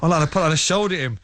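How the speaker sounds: ordinary talk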